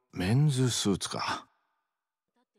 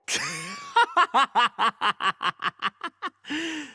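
hearty laugh. Acted. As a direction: laugh out at your friend's stiff look. Mocking buddy's suit.

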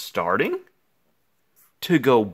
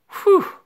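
high relief whew; 7 dB louder than usual. Acted. Relief all is normal.

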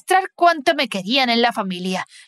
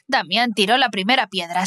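ordinary delivery, nothing marked

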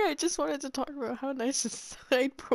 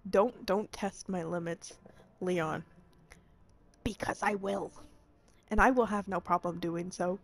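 lower, softer teasing purr; sultry threat; 6 semitones lower, 7 dB quieter than usual. Acted. Flirting with Leon in a low, soft mock threat. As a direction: tease him with a low, soft warning.

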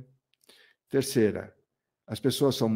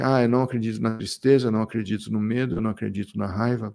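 rushed list of denials in mimicry; quick, dismissive imitation of someone in denial; fast mocking quote; faster than usual. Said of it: "que fala assim".

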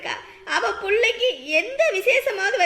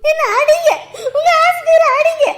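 loud, much higher sobbing challenge; wife crying at husband to go ahead and hit her; crying defiance; 9 semitones higher, 9 dB louder than usual. Acted. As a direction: scream through tears, daring him.

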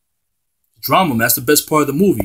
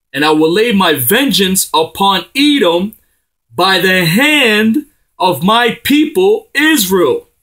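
full-force preaching; 5 semitones higher, slower than usual. Acted. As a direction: thunder God's vengeance at the enemy.

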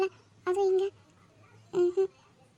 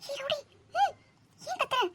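higher, softer urgent telling-off; hushed scold; 7 semitones higher, 3 dB quieter than usual. Acted. Husband scolding wife quietly so neighbours won't hear. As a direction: scold her in a hush.

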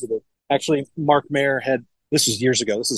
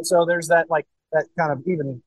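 ordinary delivery

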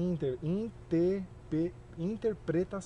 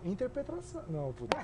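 normal talking voice

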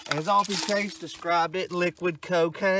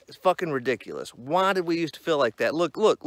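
normal talking voice

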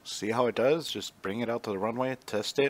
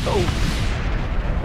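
sudden high yelp of shock; loud surprised cry; startled yelp; 12 dB louder than usual. Real, not acted. Crash landing shock.

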